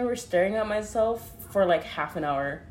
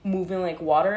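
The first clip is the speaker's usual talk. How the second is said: ordinary talk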